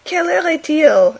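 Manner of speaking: "Quelle heure est-il" high and loud in cartoon French; mock French voice. French comic characters talking nonsense, mock French. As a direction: ask the time like a cartoon Frenchman. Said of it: "they say things like".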